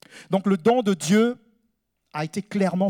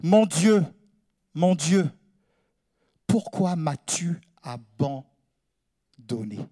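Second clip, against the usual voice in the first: broken by long pauses, sinking lower; slow solemn lament; 3 semitones lower, slower than usual. Acted. Preacher translating Jesus' cry from the cross, slow lament. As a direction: let each phrase hang, dropping away word by word.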